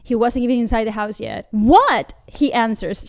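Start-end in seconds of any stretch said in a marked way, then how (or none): 1.5-2.1 loud one-word shout; shouting back. A narrator's reading. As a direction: holler back to someone in another room. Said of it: "shouting back at the adorable figure".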